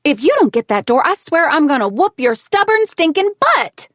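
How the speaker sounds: loud high furious threat, slowing at the end; angry threat. A narrator's reading. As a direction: threaten him, boiling over. Heroine threatening grandpa.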